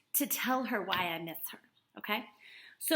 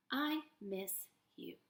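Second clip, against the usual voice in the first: soft slow read; 3 semitones lower, 9 dB quieter, slower than usual. Shown as a demonstration. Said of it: "quieter repeat".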